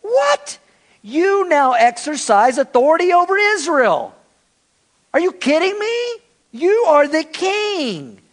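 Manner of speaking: high, incredulous, mocking scold; scornful Jezebel. Jezebel scoffing at her sulking husband Ahab. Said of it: "his wife, said to him".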